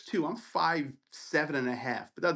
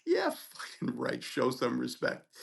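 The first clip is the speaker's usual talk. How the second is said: scornful retort; 2 semitones lower, slower than usual. Retold or imitated. Student answering the resident who screamed at him, scornful retort. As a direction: throw the words back slowly, unimpressed.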